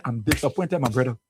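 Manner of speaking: put-on low, quiet disappointed old man; old man's low lament. Old supporter telling host of disappointment, low and quiet. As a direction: sigh it out low like a let-down elder. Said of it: "That old man".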